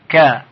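"ک" as a short "ka"; one syllable, "ک" followed by short "a"; short fatha. Shown as a demonstration. Teacher demonstrates the first part, short fatha sound. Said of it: "حرف با صدای فتحه".

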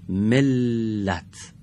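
ملت stretched very slowly, the doubled ل held; slow demo word. Shown as a demonstration. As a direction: read ملت syllable by syllable for the class. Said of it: "کدام حرفش دارای تشدیده".

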